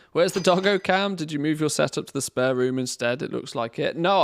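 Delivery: normal talking voice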